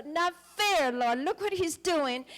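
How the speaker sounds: a re-enacted shout of complaint; retold shout. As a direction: cry out that it isn't fair. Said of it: "but I shouted".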